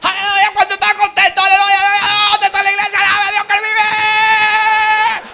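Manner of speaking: high, loud hammed-up hallelujahs; mock hysterical shouting. Mocking a shouting church, high hysterical praise. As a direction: parody a screaming worship leader. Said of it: "todo grito".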